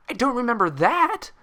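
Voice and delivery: high, loud accusing snap; accusatory snap. Acted. Twilight snapping accusingly at Pinkie, high and loud. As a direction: bite back with blame. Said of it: "snapped in an accusatory tone".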